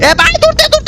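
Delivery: high, loud panicked yelp; panicked cry to run; panicked shout. Real, not acted. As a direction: scream to run in fright. Monster heard the sound, fleeing.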